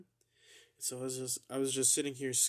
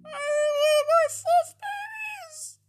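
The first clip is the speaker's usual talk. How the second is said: slow, much higher hammed-up sob; mock crying wail; 9 dB louder, much slower than usual. Mourning the eaten marshmallow, hammed-up crying wail. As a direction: wail it like a heartbroken child.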